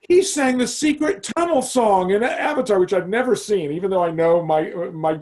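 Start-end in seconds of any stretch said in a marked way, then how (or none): 0.0-2.8 put-on high, eager child voice; excited daughter. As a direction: tell it like an excited kid. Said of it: "she was like".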